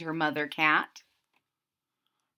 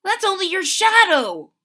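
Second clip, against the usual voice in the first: high, loud, kindly reassurance; kind big brother; 11 semitones higher, 9 dB louder than usual. A narrator's reading. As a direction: reassure her gently as a brother. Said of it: "Herbie says kindly".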